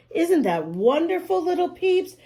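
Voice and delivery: put-on cheerful teacher to kids; delighted. A narrator's reading. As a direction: beam at the little listeners.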